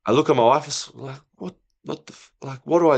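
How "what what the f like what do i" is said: retold panic. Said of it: "started freaking out".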